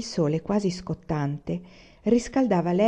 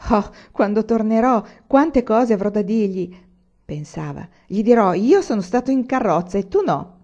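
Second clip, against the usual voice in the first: put-on child's thoughts, higher and much louder; excited boy; 3 semitones higher, 6 dB louder than usual. Anania imagines boasting to Zuanne, excited childish thoughts. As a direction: gush like a boy dreaming of bragging.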